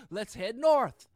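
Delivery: loud, decisive voicing of a migrant; acted call to leave. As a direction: call it out loud like a fed-up refugee. Migrant deciding to flee north.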